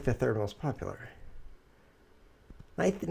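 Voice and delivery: ordinary talk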